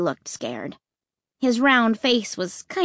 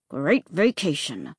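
through clenched teeth; 5 semitones lower than usual. Acted. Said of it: "through clenched teeth".

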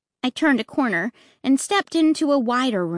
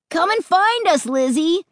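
calling out; 7 semitones higher, 3 dB louder than usual. Acted. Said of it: "Luke called".

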